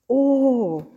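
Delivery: sudden wonder at the sight; amazed oh. A narrator's reading. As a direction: let out a wondering oh. Innkeeper melts at the baby.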